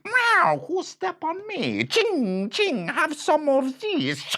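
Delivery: very high loud meow and hiss; angry cat. Acted. Cat yowls and scratches the bandit. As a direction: yowl it like a stepped-on cat. Stepped-on cat fights back.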